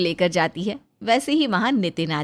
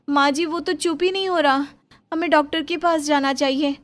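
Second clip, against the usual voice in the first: lightly voiced young wife, higher and anxious; worried young bahu; 6 semitones higher than usual. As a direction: plead gently with your mother-in-law. Bahu asks for doctor. (हल्की ऊँची आवाज़ में परेशान बहू)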